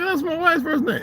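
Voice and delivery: high, amazed exclaim; surprised. Retold or imitated. Telling the neighbour it's his wife's name, surprised. Surprised remark to new acquaintance.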